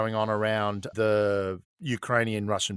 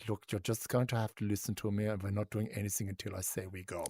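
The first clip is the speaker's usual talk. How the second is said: a hint of a firm German chancellor talking low; stern Merkel voice; 3 semitones lower, 10 dB quieter than usual. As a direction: lower and much softer, a lightly voiced stern professor. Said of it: "a chemical professor going".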